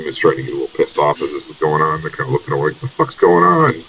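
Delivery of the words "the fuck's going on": annoyed. Acted. Annoyed bystanders in line.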